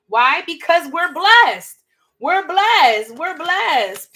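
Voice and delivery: a touch of preacher hype on blessed; hyped-up declaration. Real, not acted. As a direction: pump the crowd up. Hyping up the blessing.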